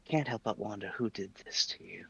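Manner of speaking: soft unvoiced whisper. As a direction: breathe it out as a whisper. Character murmurs a dark question.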